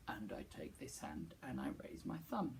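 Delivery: low breathy whisper. Shown as a demonstration. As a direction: whisper the line back. Whispered echo.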